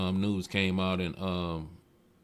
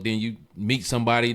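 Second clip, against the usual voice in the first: normal talking voice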